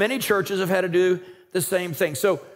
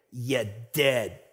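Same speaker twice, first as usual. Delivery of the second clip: low, quiet Italian mafia threat; deep Italian accent; 9 semitones lower, 5 dB quieter than usual. Acted. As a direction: growl it low like an Italian mob boss. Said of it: "in their deep Italian accent".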